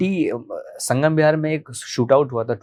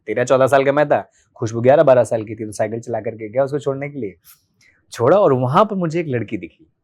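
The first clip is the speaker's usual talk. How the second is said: ordinary talk, nothing marked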